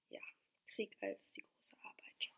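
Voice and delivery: very soft, whispered comment to oneself; whispered aside. Real, not acted. Reader pausing over war as great work, whispered aside. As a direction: murmur it under your breath.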